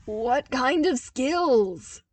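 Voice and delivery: loud, slow demanding shout; shrill demand. Acted. Zev shouting at Luke about his childhood skills. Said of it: "Zev demanded shrilly".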